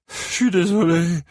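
put-on high-pitched pleading drunk voice; apologetic drunk. A narrator's reading. Pinned drunk apologising to his attacker, high pleading. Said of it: "n'arrêtait pas de répéter l'ivrogne".

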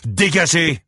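high, loud, angry shout; shouted order. A narrator's reading. Barman throwing Ike and Buddy Lee out, shouting. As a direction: shout them out of the bar.

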